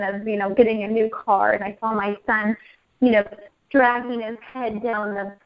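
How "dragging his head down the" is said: ordinary talk, nothing marked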